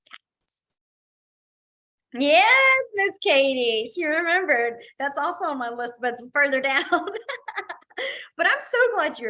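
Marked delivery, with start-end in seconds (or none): 0.1-0.6 whisper. Shown as a demonstration. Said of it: "whispered sign demo". 2.2-4.8 high delighted cry of yes; excited yes. Real, not acted. Excited praise of student.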